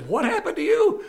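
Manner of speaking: high-pitched incredulous question; hammed-up shocked boss; astonished boss. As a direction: pitch shoots up in mock disbelief. Boss reacts to breakdown news.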